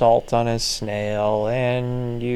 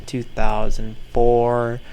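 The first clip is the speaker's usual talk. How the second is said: ordinary talk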